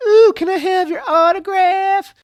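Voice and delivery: gushing fan. Retold or imitated. Receptionist to a famous actress she just recognized, gushing in an exaggerated high voice. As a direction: put on a high, starstruck fan voice. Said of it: "she's all like".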